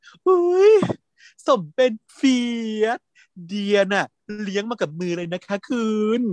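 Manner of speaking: high, gushing mother voice; proud mother. Retold or imitated. Mother boasting to father on the balcony, high and gushing. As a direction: coo over your favourite child.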